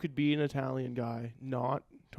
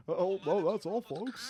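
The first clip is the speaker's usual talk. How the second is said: mock Porky Pig; 6 semitones higher, slower than usual. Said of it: "like that pig from the".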